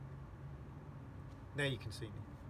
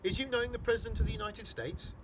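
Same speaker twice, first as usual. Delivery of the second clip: high-pitched, awed question; politely incredulous, pitched well up; respectful; 8 semitones higher, 4 dB louder than usual. A narrator's reading. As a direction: ask it with hushed-up awe but raised pitch. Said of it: "in a rather respectful voice".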